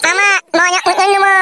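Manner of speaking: put-on high, pleading child's voice; whiny little boy. Small son begging father for big doll, whining. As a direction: beg like a spoilt kid.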